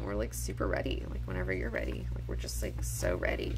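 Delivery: low, soft, whispered ready; mock ready before the segment; deadpan whisper. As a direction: murmur it flatly.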